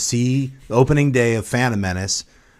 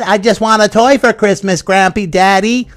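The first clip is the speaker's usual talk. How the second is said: put-on creepy child; 7 semitones higher, 4 dB louder than usual. Mocking the child actor, lightly voiced whiny kid. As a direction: whine like a spooky kid. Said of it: "like a creature from hell".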